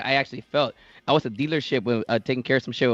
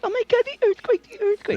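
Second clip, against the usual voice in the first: much higher, shrill mock panic over the earthquake; mocking panicked people. Mocking people who panic about the earthquake, high mock alarm. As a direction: parody the overreacting crowd. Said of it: "people are like".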